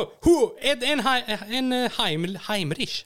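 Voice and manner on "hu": high, loud effort heave; effort grunt. Retold or imitated. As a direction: grunt as if thrusting a Heimlich. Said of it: "gick bara". (hög, kraftig ansträngningsgrymtning)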